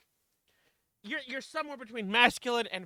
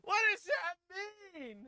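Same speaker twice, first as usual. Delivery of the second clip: high, drawn-out British femboy voice; slow, high-pitched British femboy; 11 semitones higher, much slower than usual. Acted. Player tries a voice for the new character, British femboy. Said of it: "do a British femboy".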